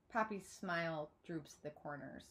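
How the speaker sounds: usual speaking voice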